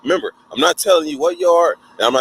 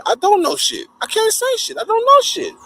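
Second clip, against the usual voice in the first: high, rising denial; pleading and repeating; frantic denial. Retold or imitated. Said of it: "they realized".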